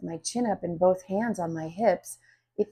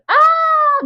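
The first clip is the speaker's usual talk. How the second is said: startled high-pitched shriek; loud high startled yelp; high scream; 13 dB louder than usual. A narrator's reading. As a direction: shriek in sudden fright. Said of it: "Missus Green screams".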